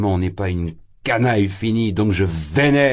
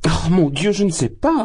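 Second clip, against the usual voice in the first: flustered mother; 10 semitones higher, 4 dB louder than usual. Acted. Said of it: "répondait la pauvre Poulkeria Alexandrovna".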